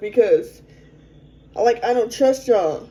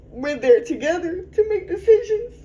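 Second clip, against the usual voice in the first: real tears; 7 semitones higher than usual. Real, not acted. Remembering the birth without her partner, tearfully crying. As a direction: voice rising higher and breaking into crying.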